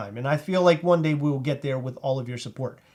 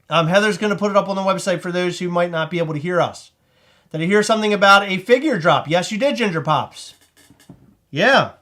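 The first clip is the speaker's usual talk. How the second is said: ordinary delivery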